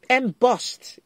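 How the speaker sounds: Embossed called out loud with a fall; loud exclaim. Real, not acted. A crafter finally recalls the word and calls it out loud. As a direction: blurt Embossed out loud when the word comes. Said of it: "sorry if I yelled it in your ears".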